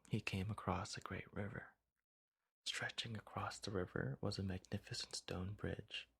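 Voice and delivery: ordinary delivery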